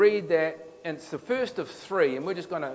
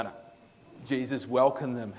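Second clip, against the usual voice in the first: ordinary talk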